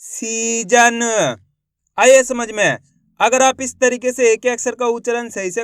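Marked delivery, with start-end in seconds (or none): 0.0-1.0 "सीजन" very slowly as one word; slow teaching read of "सीजन"; blended, drawn-out word. Shown as a demonstration. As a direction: stretch each syllable of "सीजन", linked. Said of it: "तो मिलके बन जाएगा".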